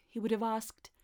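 plain reading voice

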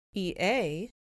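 ordinary delivery

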